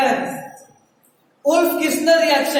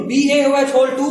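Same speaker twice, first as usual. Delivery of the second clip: dictation pace; slower than usual. Shown as a demonstration. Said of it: "नीचे में reaction ही लिख लो".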